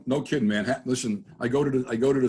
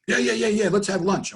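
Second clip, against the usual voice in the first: high, loud burst of eager agreement; eager dad impression; 10 semitones higher, 4 dB louder than usual. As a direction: jump in instantly as the keen old dad. Dad eagerly answers low question.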